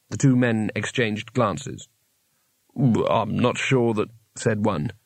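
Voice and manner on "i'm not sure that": hesitant guard voice. Acted.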